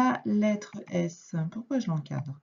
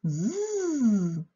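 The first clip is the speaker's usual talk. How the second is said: a long buzzing zzz like a lisping snake; 3 semitones higher than usual. Shown as a demonstration. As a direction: buzz it out like the timid snake. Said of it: "Il se met à zozoter".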